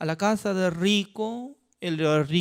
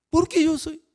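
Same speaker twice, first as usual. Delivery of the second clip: higher, protesting question; indignant surprise; 10 semitones higher, 3 dB louder than usual. Acted. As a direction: push back in disbelief. King protests accusation.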